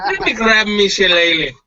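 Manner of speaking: mock Irish brogue; hammed-up leprechaun brogue; Irish accent. Answers with mock Irish line.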